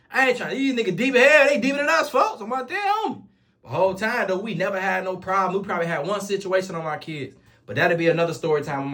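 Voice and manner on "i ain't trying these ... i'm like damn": rising alarm. Retold or imitated. Fears the bigger gang.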